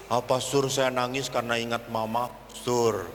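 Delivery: low, sheepish mock confession; confessing child, imitated. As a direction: own up to the priest in a low voice. Acted tearful girl confessing to priest.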